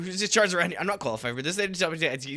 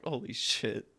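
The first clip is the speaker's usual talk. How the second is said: normal talking voice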